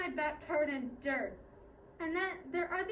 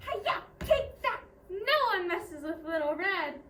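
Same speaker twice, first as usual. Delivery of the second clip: fighting cry; 3 semitones higher, 6 dB louder than usual. Acted. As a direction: shout it like landing a martial-arts strike. Karate attack on grabbing wolf.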